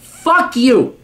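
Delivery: high-pitched, forceful dismissal; angry outburst. Real, not acted. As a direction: spit it out in outrage. Angry rant at letter writer.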